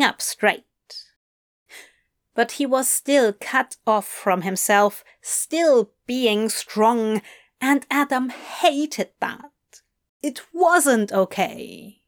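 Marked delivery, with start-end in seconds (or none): none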